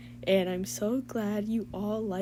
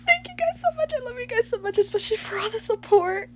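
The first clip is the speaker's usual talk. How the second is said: gushing thanks; 12 semitones higher, 5 dB louder, faster than usual. Real, not acted. Emotional thanks to fans.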